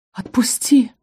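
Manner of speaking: a hushed, breathless plea; pained whisper. A narrator's reading. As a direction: whisper it through the pain. Cries out in pain. (шёпотом, от боли)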